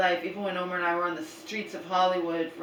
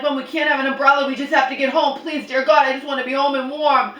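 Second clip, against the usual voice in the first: desperate pleading; 6 semitones higher, 7 dB louder than usual. Retold or imitated. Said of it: "I'm like".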